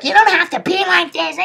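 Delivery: high whiny imitation of a wife; mock the complaint in a very high voice; mocked unhappy wife. Wife unhappy at his yelling, high mocking imitation. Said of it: "She's like".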